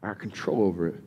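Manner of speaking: low, quiet, self-satisfied; smug self-assurance. Retold or imitated. Believer telling himself he has it handled, quiet and smug. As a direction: mutter it like a man sure he's in charge.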